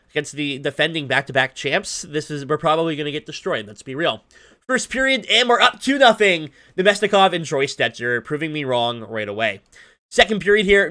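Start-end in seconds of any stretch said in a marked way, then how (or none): none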